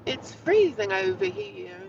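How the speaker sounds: high, loud, slow cry of cold; gasping in icy wind. Real, not acted. Cold morning outdoors.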